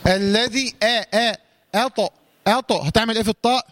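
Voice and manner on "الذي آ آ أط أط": "أط" with a light a, then a heavy bouncing ط; broken-down "أط", light then heavy; light hamza, bounced ta. Shown as a demonstration. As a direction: keep "آ" thin, then echo the ط of "أط". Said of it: "قلقل الطاء".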